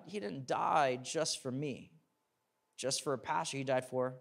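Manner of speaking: ordinary talk, nothing marked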